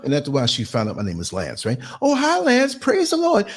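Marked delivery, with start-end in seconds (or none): 2.0-3.5 high, lilting praise-the-Lord greeting; sing-song church lady. Retold or imitated. Churchy passenger greeting her bus driver, high sing-song. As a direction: chirp it like a devout church lady.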